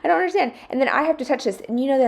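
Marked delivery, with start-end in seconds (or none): 0.0-0.5 pitched way up in exasperation; high exasperation. Real, not acted. Mom exasperated by her family, pitched up high. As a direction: throw it up high in disbelief. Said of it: "Why can't my family do the same?".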